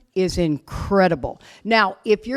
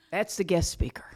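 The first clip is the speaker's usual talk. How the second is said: whispered aside; 3 semitones lower, 6 dB quieter than usual. Real, not acted. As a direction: hush it like a secret. Aside about her own role.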